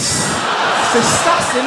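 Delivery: ordinary talk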